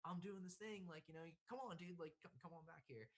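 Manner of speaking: high-pitched urging, easing in volume; imitated eager beckoning. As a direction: act out waving a friend over. Artist urges him to come back.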